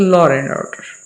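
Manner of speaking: "law and order" slow, with an r gliding in after law; law-r-and order, run together; linking r. Shown as a demonstration. Teacher modelling the intrusive r for students. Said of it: "law and order जब pronounce करेंगे".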